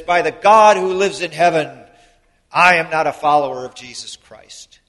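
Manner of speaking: loud, forceful denial; vehement oath. Retold or imitated. Peter swears to the crowd he is no follower, forceful and loud. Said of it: "he says".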